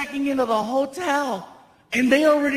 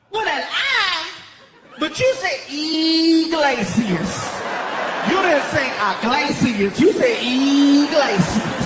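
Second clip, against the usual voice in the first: arguing clerk; 3 semitones higher than usual. Retold or imitated. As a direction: argue it like she's sure. Clerk arguing about name.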